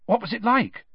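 high, keen question; eager. Acted. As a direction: ask it eagerly, leaning in. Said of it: "said Harry eagerly".

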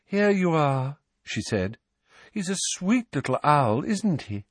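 a hint of dreamy wonder; dreamy Luna. A narrator's reading. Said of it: "said a dreamy voice".

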